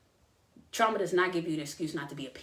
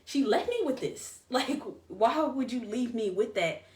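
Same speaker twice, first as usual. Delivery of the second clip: higher, laughing through the hurt; bitter laughing disbelief; 6 semitones higher than usual. Retold or imitated. Sixteen-year-old, alone after the news, laughing in disbelief. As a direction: laugh at how absurd it was to be left like that. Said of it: "I was like".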